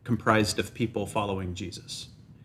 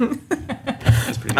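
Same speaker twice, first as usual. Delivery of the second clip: laughing; 4 dB louder, much slower than usual. Real, not acted. Laughs at the answer.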